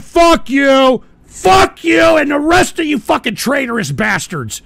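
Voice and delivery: loud, furious cursing; angry outburst. Real, not acted. Host cursing out traitorous trolls in his chat, angry shouting. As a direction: lash out at the whole lot.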